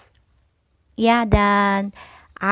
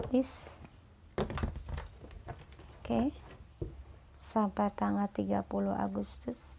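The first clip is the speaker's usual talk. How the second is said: soft, whispered prayer to the cards; murmured invocation; 10 dB quieter than usual. Acted. Quiet invocation over tarot cards.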